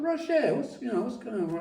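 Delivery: high, concerned question to Roger; quoted worried wife. Retold or imitated. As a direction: lightly voice Linda asking what happened. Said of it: "she said".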